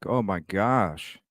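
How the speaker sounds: ordinary talk, nothing marked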